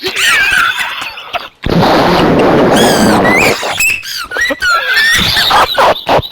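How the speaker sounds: long, loud high-pitched shrieking laugh; helpless laughter. Real, not acted. Friend cracking up at the other's silly voice, helpless laughter. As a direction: burst out in a high, uncontrollable cackle. Friend mocked for anime girl voice.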